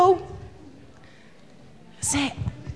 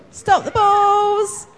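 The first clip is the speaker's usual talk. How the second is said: slow, loud call; 5 semitones higher, 5 dB louder, much slower than usual. Real, not acted. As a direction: call it out slowly. Ends the ball throwing.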